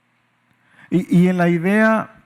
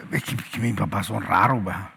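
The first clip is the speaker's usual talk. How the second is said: lower, put-on grumbling voice of a grown child; mock the child who finds their parents weird; lightly acted complaining son; griping child; 11 semitones lower, 5 dB quieter than usual. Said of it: "dices tú".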